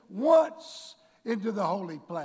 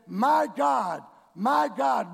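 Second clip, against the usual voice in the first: loud cry of anguish; 4 semitones higher, 8 dB louder, much slower than usual. Acted. Preacher voicing Jesus on the cross, loud cry.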